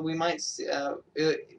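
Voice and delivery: usual speaking voice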